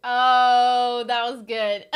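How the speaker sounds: loud burst of delight; loud delighted reaction. Real, not acted. Streamer loudly praising a joke, delighted. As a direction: cheer it out, loving the joke.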